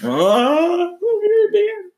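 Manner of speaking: high, loud, hammed-up sobbing; a falsetto sob as the news sinks in; mock crying. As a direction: break down crying over being European. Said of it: "I'm still in shock".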